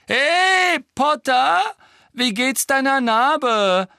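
very high, loud drawn-out heckle; taunting shout. A narrator's reading. Malfoy heckles Harry about his scar, taunting shout. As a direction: holler it mockingly from the stands. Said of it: "rief Malfoy".

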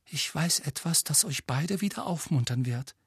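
high, tentative, a touch tremulous; slightly trembling voice. A narrator's reading. Hermine tries to cheer both boys, slightly trembling. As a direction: offer good news a little nervously. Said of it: "ihre Stimme zitterte leicht".

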